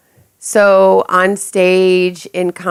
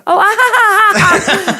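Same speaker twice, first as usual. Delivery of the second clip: mock laughing; 13 semitones higher than usual. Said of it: "kept repeating things that they were doing".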